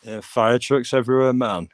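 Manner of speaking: ordinary talk, nothing marked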